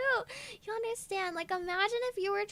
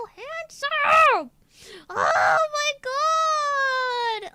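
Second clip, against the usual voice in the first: gushing squeal; 8 semitones higher, 12 dB louder, much slower than usual. Real, not acted. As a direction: squeal over the handsome dog. Cooing over her dog.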